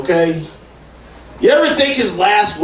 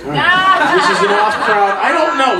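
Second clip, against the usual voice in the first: usual speaking voice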